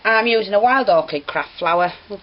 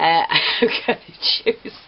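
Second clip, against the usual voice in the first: laughing; 6 semitones higher, much slower than usual. Real, not acted. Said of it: "don't get me laughing".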